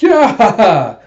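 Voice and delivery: a high, loud whoop in another voice; excited yeah. Retold or imitated. Dusty cheering the cyborg plan, loud whoop. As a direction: burst out with glee.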